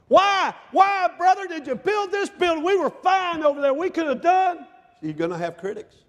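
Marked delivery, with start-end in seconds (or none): 0.1-4.7 high, loud indignant griping; complaining critic. Retold or imitated. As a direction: whine like a critic who wanted no building. Church critics complaining, acted.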